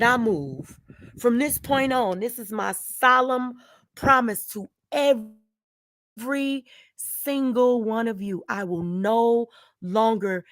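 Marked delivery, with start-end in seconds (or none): none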